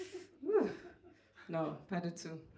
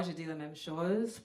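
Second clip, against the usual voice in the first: ordinary talk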